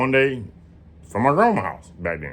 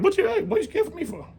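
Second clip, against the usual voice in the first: much higher and quicker, startled; alarmed question; faster than usual. Retold or imitated. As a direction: fire it back at grandma in alarm. Panicked question to scared grandma.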